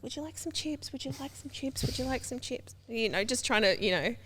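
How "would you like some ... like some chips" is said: softer, higher, sing-song offer repeated; offering chips sweetly. Retold or imitated. Naive teen offering chips at a drug party, soft and high. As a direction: go round the party softly offering snacks. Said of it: "I'm like".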